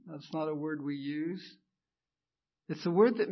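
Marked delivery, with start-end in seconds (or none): none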